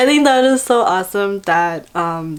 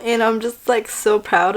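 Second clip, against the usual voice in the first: ordinary delivery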